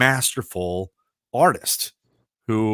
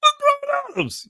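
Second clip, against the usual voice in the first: gushing fans; 6 dB louder than usual. Retold or imitated. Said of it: "All [name] fans go".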